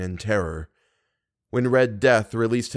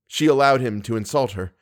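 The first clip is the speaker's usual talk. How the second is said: ordinary delivery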